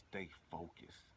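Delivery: ordinary talk, nothing marked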